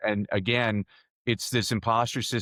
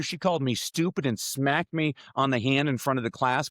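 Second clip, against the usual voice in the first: normal talking voice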